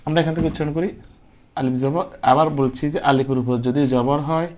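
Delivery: ordinary talk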